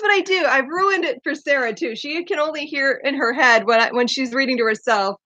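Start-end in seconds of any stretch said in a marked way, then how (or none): none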